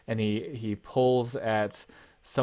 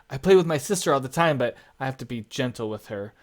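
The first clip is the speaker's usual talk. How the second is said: put-on a kid blurting out his question; twelve-year-old boy; 3 semitones higher, 3 dB louder, faster than usual. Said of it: "He says".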